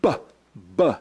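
B as a short, isolated "buh"; letter sound demo. Shown as a demonstration. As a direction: sound out B twice, with a pause between. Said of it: "and that one is".

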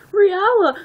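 high, loud call; urgent high-pitched shout; crying out. A narrator's reading. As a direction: cry out the name in alarm. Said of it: "I cried".

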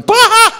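very loud, high mock burst of laughter; imitated laughing outburst. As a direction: explode into a sudden high "Bah!". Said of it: "starts just sort of going".

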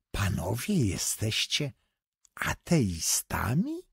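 slow, breathless squeak of curiosity; squeaky with curiosity. A narrator's reading. Foreigner asking the writers, squeaky with curiosity. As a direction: ask as if you can hardly believe it. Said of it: "głosem piskliwym z ciekawości zapytał".

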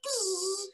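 very high buzzing; mosquito buzz. Acted. Mosquito flies out.

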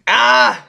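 high yelp from the burn; pained cry. Real, not acted. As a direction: yelp as the heat hits. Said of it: "It's really burning".

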